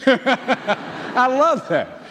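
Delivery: laughing through the words, slow and loud; amused, let the laugh carry it. Real, not acted. Delighted by the children's lesson, laughing.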